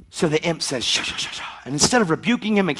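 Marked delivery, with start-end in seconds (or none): 0.8-1.4 soft, hissing shh sounds; the imp slinks in whispering; unvoiced shushing.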